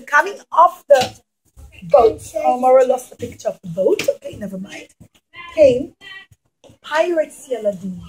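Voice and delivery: ordinary delivery, nothing marked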